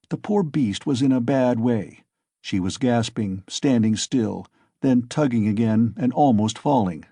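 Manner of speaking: usual speaking voice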